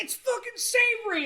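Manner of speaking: high-pitched mock outrage; indignant retold complaint, high; mock indignation. Retelling friend's indignant complaint.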